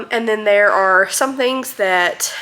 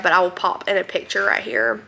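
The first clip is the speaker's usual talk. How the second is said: ordinary delivery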